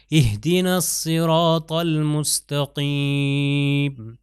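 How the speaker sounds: slow recitation with a heavy ص in الصراط; heavy saad. Shown as a demonstration. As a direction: chant الصراط slowly with a full, heavy ص. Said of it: "صاد جو ہے موٹا ہے".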